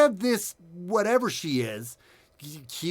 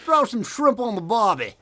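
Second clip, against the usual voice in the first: hammed-up Aussie, pitched higher; mock Australian twang, much higher; bad Australian accent; 7 semitones higher, 6 dB louder, slower than usual. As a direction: play a cartoon Australian for laughs. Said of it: "he's bloody terrible as an Aussie".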